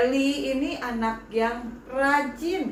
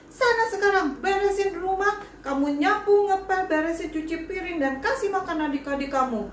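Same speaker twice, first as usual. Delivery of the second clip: higher, angry scolding voice; angry mother ordering her child to clean the house; furious mother; 5 semitones higher than usual. Acted. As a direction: bark out the chores at the naughty child.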